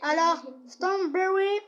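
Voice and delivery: slow careful strawberry; English word. Shown as a demonstration. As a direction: say strawberry slowly, as a French kid reads English. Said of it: "ça veut dire fraise".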